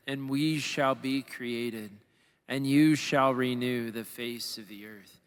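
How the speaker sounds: low, slow, solemn prayer voice; measured liturgical invocation; opening prayer. Acted. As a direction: pray it slowly and reverently, pitched low.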